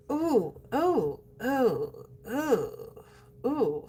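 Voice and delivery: soft wincing oohs; mock dread; pained ooh. Real, not acted. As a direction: wince at the thought of the budget. Reacting to the budget.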